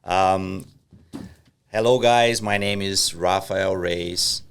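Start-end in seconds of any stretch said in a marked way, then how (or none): none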